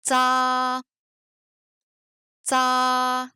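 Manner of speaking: "tsa" long and level on one pitch; drawn-out tsa, twice with space between; syllable drill. Shown as a demonstration. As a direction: hold the a of tsa evenly for repeating. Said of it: "on va ajouter des voyelles".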